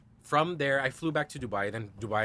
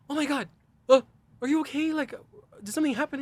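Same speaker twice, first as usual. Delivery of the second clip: high, loud retold worry; alarmed concern; 9 dB louder than usual. Boyfriend seeing his partner crying, alarmed concern. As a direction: fire worried questions in a lifted voice.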